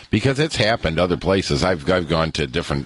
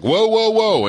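high, loud startled whoas; retold startled exclamation; 13 semitones higher, 4 dB louder than usual. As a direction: yelp it like she just caught herself.

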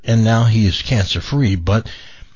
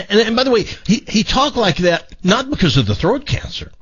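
normal talking voice

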